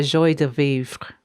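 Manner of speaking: slow, clumsy French pronunciation; terrible French accent. Real, not acted. Reader who can't say it properly, rough French accent. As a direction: attempt the French phrase in a poor accent. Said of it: "apologize my terrible accent".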